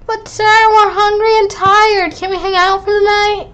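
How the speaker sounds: high, sing-song whine; whiny student. Acted. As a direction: whine like a tired kid. Student voice whining to teacher.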